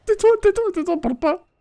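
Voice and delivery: high frantic plea to hush; panicked pleading. Real, not acted. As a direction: beg them to shut up. Panicked hushing while hiding.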